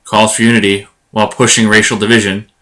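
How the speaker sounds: measured, punchy show-opener headlines; headline intro read. Acted. Host teasing this episode's topics to listeners, headline intro read. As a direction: announce the episode's topics in separated, deliberate phrases.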